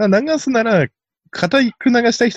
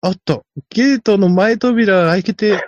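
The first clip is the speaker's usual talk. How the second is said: mock commentary jumping higher and louder at a mishap; raised-voice announcer impression; stalling race announcer; 6 semitones higher, 4 dB louder than usual. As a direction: call a sudden gate incident like a trackside commentator. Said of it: "なんとか場をつなごうとする実況中継の人".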